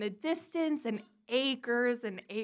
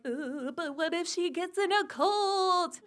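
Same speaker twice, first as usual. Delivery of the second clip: high, whimpering mock worry; worried mom impression; 6 semitones higher than usual. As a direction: fret tearfully as an anxious mother. Worried mom pleading, acted out.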